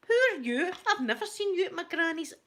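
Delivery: loud, high confrontation; indignant challenge. Retold or imitated. Confronting gate-crashers at the funeral, loud indignant challenge. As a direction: call out the strangers loudly. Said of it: "I felt like saying".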